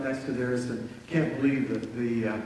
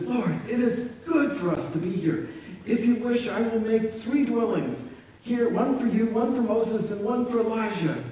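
a touch higher, eager disciple; lightly voiced Peter; 7 semitones higher than usual. Peter eagerly offers Jesus three dwellings, lightly voiced higher. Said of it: "Then Peter said to Jesus".

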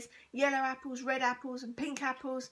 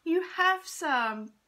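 slow, high and louder with delight; 5 semitones higher, 9 dB louder, much slower than usual. A narrator's reading. Mum thrilled at the shopkeeper, slow, high and loud. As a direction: stretch it out, thrilled. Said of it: "cried out Mum".

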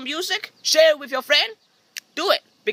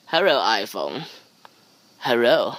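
deep mock hello; 13 semitones lower than usual. Saying hello to the iPhone reflection, very low. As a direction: greet it like a deep-voiced stranger.

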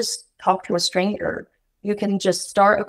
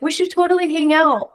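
bright, much higher, gushing promise; mock eager American; 8 semitones higher than usual. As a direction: play the overeager new friend. Imitating an overeager American.

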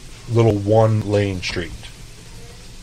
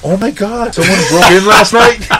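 high, loud fake surprise; mock alarmed reply to Lucy; mock shock; 12 semitones higher, 11 dB louder than usual. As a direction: act stunned with a hammed-up gasp. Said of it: "And I was just like".

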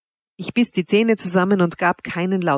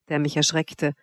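ordinary delivery, nothing marked

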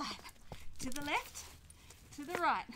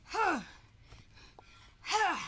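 sharp loud huh on each kick; kick grunt; 5 semitones higher, 4 dB louder than usual. Shown as a demonstration. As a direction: grunt it out hard as you kick. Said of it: "So we wanna go".

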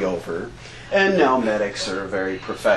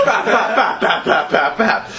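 laughing bap bap gunfire noises; mimicked gunfire; 6 semitones higher than usual. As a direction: spit out the shots like a machine gun. Imitating machine gun fire.

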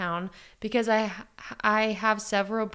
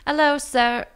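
higher, louder British sales greeting; British accent; 6 semitones higher, 6 dB louder than usual. Acted. Salesperson hailing a customer, British accent. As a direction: greet like a British shop assistant. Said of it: "Try to do it".

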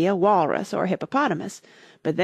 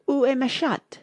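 schoolgirl French; 7 semitones higher than usual. A narrator's reading. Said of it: "first sentence in her French lesson book".

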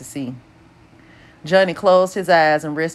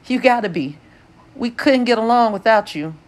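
forced cheer; 7 semitones higher than usual. A narrator's reading. Pony forcing cheer.

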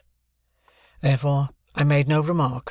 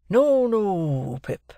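Joe, the kind blacksmith; 6 semitones higher, much slower than usual. Acted. Joe voiced in the novel.

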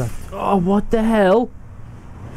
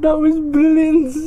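laughing; 11 semitones higher, 4 dB louder than usual. Real, not acted. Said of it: "I can't even breathe".

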